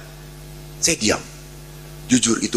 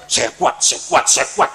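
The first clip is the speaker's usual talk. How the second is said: self-encouragement; 4 dB louder than usual. Real, not acted. Bracing himself for punishment.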